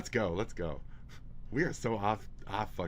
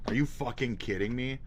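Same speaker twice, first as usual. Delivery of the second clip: ordinary talk, nothing marked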